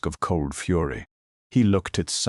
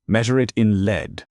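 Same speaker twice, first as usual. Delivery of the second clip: deadly threat; 6 semitones higher, 6 dB louder than usual. A narrator's reading. Threat with cocked gun.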